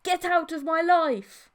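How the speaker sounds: high, loud, slow defiant shout; a defiant cry to end it all; shout from the soul. A narrator's reading. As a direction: throw her out with everything you have. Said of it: "shouted from my soul".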